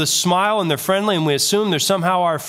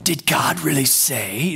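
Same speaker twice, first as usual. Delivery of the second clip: cartoon serpent voice; slower than usual. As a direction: drag the words out like an obvious cartoon villain. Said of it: "the voice they put on when it's the serpent".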